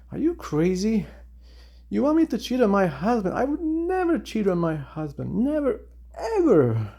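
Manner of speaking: high-pitched, outraged mock refusal; indignant neighbor's wife. Neighbor's wife rebuffing an advance, in high mock indignation. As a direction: play an offended wife, pitched well up. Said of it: "in a really bad mood".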